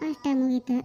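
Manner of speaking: ordinary talk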